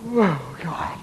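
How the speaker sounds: soft breathy whispered sigh. Acted. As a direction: whisper it to yourself. Sailor alone, tired.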